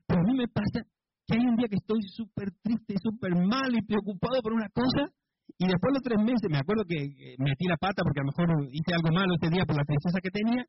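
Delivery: usual speaking voice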